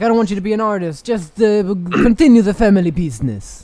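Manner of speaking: put-on a fast, loud Italian vampire accent as a stern dad; pizza vampire accent. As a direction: rattle off the dad's orders in the Italian vampire accent. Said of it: "pizza vampire that you just slipped into".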